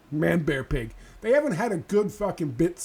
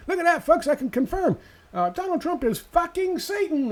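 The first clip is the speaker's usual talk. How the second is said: high, loud gushing newsman; hammed-up cable host thrilled; excited Hannity; 13 semitones higher, 4 dB louder than usual. Hannity excitedly confirming the news to viewers, high and loud. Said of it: "Hannity's all excited".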